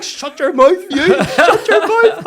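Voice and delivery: high, very loud order; mock furious shout. Man pulling coach back from linesman, high furious shout. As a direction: yell it high, laughter close behind. Acting out touchline row.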